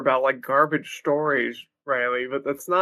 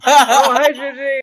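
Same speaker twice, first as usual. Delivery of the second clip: excited greeting; 9 semitones higher, 9 dB louder than usual. Real, not acted. Greeting arriving friend, loud high.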